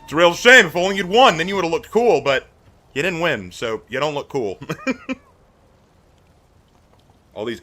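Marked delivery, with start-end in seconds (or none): 0.0-4.6 loud, higher sing-song gloating; hammed-up teasing taunt; mock taunt. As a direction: rub it in with fake pity. Taunting the defeated player.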